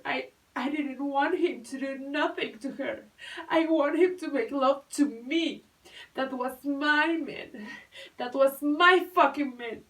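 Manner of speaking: loud, high and building to shouting; anguished outburst. Acted.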